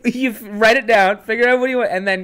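loud, high, hurried instruction; impatient teacher. Retold or imitated. Teacher tells the student to write it down, loud and impatient. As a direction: hurry her along like a fed-up teacher. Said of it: "He was like".

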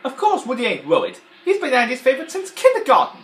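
high, loud put-on cartoon voice; Mister Potato Head impression. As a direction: sneer it like a jealous toy. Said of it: "there he goes like this".

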